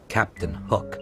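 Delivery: plain reading voice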